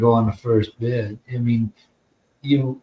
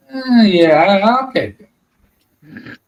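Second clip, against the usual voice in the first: higher, louder, slow reluctant shrug of a reply; faking indifference; 8 semitones higher, 6 dB louder, much slower than usual. Retold or imitated. Seller to car dealer, hiding his eagerness with a slow, bored shrug. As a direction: act like the money barely interests you. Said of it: "So I didn't wanna seem too eager".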